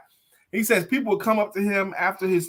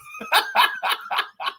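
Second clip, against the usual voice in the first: high laugh. Real, not acted. Host laughing hard.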